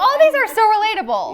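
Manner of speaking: high, loud enthusiastic gush. Retold or imitated. Host gushing to friends about relatable tips, excited gush. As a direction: burst out with delight. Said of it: "but I'm like".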